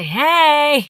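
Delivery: high, drawn-out hey; mimicked cheery greeting. Co-host mocking her return to the job, high cheery hey.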